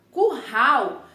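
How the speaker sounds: CURRAL with throaty H-like RR, as "cu-hau"; no tongue tremble. Shown as a demonstration. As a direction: model CURRAL without trilling the tongue. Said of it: "sua língua nem treme".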